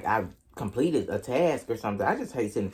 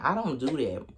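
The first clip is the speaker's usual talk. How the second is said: usual speaking voice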